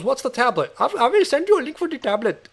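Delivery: imitating a caller's Indian accent, high-voiced. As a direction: put on an Indian call-centre accent. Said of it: "I'm doing an Indian accent".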